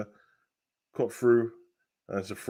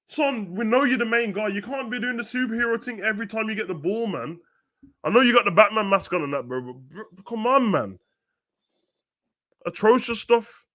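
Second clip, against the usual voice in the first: exasperated scolding; 9 semitones higher than usual. Real, not acted. Scolding player after failed run.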